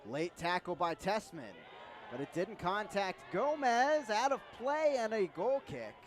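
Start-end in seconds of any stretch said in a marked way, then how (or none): 2.1-5.9 raised, urgent play-by-play call; excited. Real, not acted. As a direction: call it out over the crowd. Commentator reacting to late tackle.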